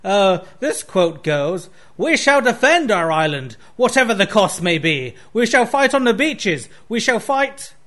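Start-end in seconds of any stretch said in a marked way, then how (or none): 2.0-7.7 Churchill speech. Retold or imitated. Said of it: "this is a quote from, uh, Winston Churchill".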